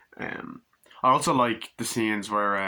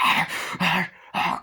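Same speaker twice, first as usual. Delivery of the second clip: high, loud animal snarls; short mock bursts of an attacking dog; imitated dog attack; 9 semitones higher, 4 dB louder, slower than usual. Revived dog attacking, high loud imitated snarls.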